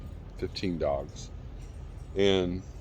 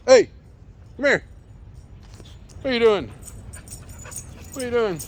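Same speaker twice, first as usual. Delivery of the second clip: much higher sing-song pet talk; faster than usual. Real, not acted. Owner asking the puppy what he's up to, high pet voice. Talking to the exploring dog.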